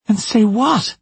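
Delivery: high, incredulous question; defensive retort. Acted. Maura challenged about calling police, high incredulous retort. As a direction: throw it back, pitch shooting up.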